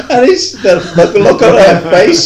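laughing. Real, not acted. Laughing recalling the joke.

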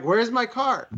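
high alarmed question; surprised. Retold or imitated. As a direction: panic at the empty spot. Finds his car towed.

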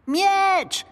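squeaky little creature crying out; creature's cry. A narrator's reading. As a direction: squeal it out as an urgent demand. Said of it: "krzyknął stworek".

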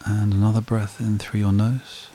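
slow, calm meditation voice with long pauses; guided meditation. Acted. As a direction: lead a breathing exercise, unhurried, leaving long silences.

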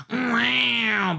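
high drawn-out wail, falling; mock wail. Fan imitating her emotional reaction, mock wail.

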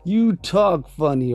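high, slurred, drawn-out drunk cartoon voice; drunk Barney voice. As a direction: slur it like the drunk Simpsons guy. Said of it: "it was Barney".

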